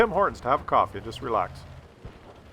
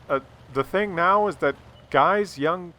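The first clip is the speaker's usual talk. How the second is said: ordinary talk, nothing marked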